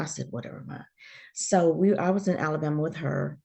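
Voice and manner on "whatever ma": breathy under-the-breath "Whatever, Ma"; dismissive mutter. Retold or imitated. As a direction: brush mom off under your breath. Dismissing her mother's teasing.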